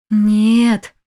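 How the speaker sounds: loud, desperate refusal. A narrator's reading. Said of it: "отчаянно сопротивлялся".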